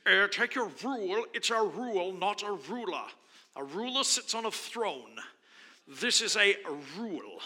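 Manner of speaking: a hint of a Scottish drafting teacher; lightly put-on Scottish lilt, lecturing; mock Scottish accent. Teacher correcting students, in a hint of Scottish accent. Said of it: "in this little Scottish accent or some kind of weird accent".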